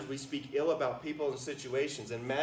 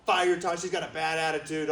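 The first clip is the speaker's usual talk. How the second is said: ordinary talk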